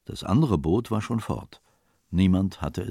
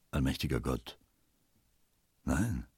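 low, soft, stunned exclamation; hushed horror; 5 semitones lower, 7 dB quieter than usual. A narrator's reading. Realising the captain's wife is lost, hushed horror. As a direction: let it fall quietly, aghast.

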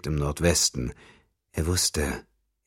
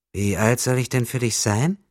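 put-on high, louder girlish voice; 7 semitones higher, 4 dB louder than usual. A narrator's reading. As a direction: coo it at him like a teasing young girl. Young prostitute voiced high. (kokette hohe Mädchenstimme)

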